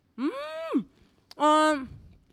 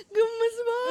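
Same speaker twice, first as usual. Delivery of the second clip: squealing laugh; 11 semitones higher, slower than usual. Real, not acted. Charmed by a cute snack.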